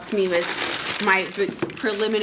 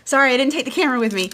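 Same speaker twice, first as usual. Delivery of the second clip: usual speaking voice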